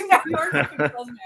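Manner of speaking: fast, laughing correction; rushed words through laughter; laughing denial. Real, not acted. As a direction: laugh as you correct him. Laughing correction of a friend's claim.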